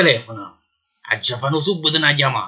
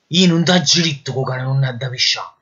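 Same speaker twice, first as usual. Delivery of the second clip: usual speaking voice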